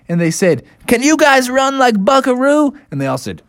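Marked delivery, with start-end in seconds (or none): 0.9-2.7 high, excited little-bear voice; eager question. Acted. Bears asking their triceratopses to race, eager. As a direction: ask your mount keenly.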